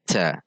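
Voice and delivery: ta said as "cha"; wrong CH sound. Shown as a demonstration. Teacher shows mistake, ta becoming cha. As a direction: turn the T of ta into CH. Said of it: "berubah menjadi huruf C".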